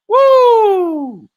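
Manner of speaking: a high, loud party whoop; excited whoop. Real, not acted. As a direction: whoop it up like you're hyping the crowd. Hyping up Lagos parties.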